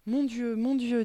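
high, alarmed exclamation; startled concern. A narrator's reading. Teacher seeing the child rush up breathless, startled concern.

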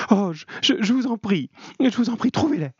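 very high, stammering plea; anguished, high-pitched begging; cry of suffering. A narrator's reading. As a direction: beg desperately, tripping over the words. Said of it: "une sorte de cri de souffrance".

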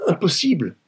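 very high, distressed exclamation; desperate refusal. Acted. Wife told to find the money, desperately refusing. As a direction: refuse helplessly, pitch shooting up.

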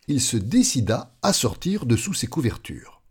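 plain reading voice

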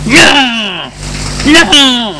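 high, piercing screams of pain; screaming no. Acted. Maurice under scalding water, high pained screams. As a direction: scream each no as the water burns. Boiling water poured on him.

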